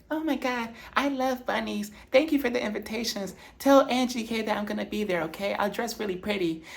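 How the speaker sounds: put-on high, soft valley-girl gush; imitating Whitney. Vlogger mimics Whitney thanking the bunny mascot, high and breathy-sweet. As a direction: gush sweetly like a delighted reality star. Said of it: "she's like".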